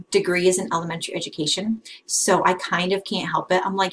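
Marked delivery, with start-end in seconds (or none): none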